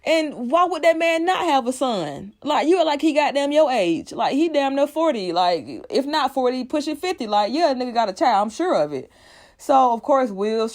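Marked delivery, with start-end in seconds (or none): 0.0-9.1 exasperated. Retold or imitated. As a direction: pitch up and scold like it's obvious. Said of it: "And we like".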